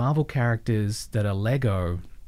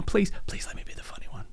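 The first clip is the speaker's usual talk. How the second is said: breathy whispered plea, pitched up; whispered begging; 9 semitones higher, 6 dB quieter, faster than usual. Retold or imitated.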